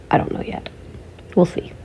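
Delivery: soft breathy whisper to herself. Real, not acted. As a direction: whisper it like a secret. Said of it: "whispered aside".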